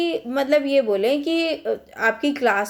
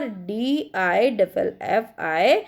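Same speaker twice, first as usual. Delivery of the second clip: letter by letter, slowly; slow spelling out; 3 semitones lower, much slower than usual. Shown as a demonstration. Teacher spelling fattest to students, slowly.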